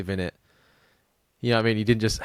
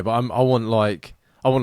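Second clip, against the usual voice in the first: ordinary talk, nothing marked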